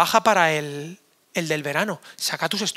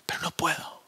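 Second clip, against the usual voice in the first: hushed whisper, breath only; 4 semitones lower, 10 dB quieter than usual. Acted. Mock convert admitting he can't serve Jesus, whispered. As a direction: whisper it as a confession.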